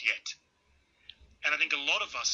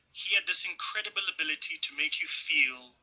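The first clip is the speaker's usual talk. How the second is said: ordinary delivery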